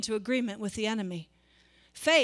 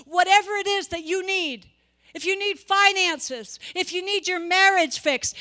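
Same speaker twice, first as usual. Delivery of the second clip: higher, louder declaring God's words; impassioned preaching; 4 semitones higher, 4 dB louder than usual. Acted. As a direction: proclaim it as God's own promise. Voicing God's promise, preaching.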